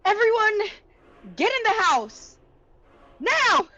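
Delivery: high, loud yelled order; yelling at everyone. Acted. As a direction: yell it urgently to the whole group. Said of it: "I yell at everyone".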